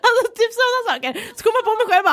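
high, loud burst of laughter into words; laughing at herself. Real, not acted. Carer cracking up retelling her blunder, loud. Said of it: "laughing at own story".

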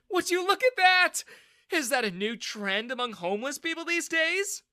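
mock him with a nasty sneer; sneering mockery. Bully mocking the soaked man, sneering loudly. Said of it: "Daniel sneered".

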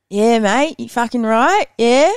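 mock Queenslander greeting accent. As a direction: imitate a Queensland accent, punchy little questions then a drawn-out finish. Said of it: "so Queenslanders, like".

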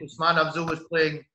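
ordinary delivery

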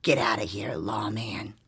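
low whispered ghost warning; ghostly whisper. Retold or imitated. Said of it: "a voice growled".

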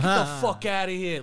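mock dismissive wiseguy; a hint of indignant mob-movie heavy; Sopranos tough guy. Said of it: "straight out of The Sopranos".

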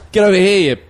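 loud, pompous penguin emperor. Pretend. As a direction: bark orders at peasant penguins. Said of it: "If you're an emperor penguin, what would you sound like?".

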